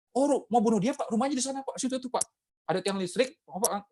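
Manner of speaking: high, eager, naive voice; silly high naive helpful voice; mock naive helper. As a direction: play the honest fool pointing the way.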